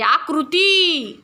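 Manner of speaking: आकृती said very slowly, final ती held; long final i. Shown as a demonstration. Teacher reads आकृती slowly for the class. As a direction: draw out the ती of आकृती. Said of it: "याला दुसरी वेलांटी पाहिजे".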